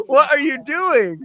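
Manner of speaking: squeaky high disbelief with a laugh; incredulous dude. Retold or imitated. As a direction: dude what are you doing, high and laughing in disbelief. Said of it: "And I'm like".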